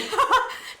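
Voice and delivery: high giggle. Real, not acted. Laughing at celebrity viewers.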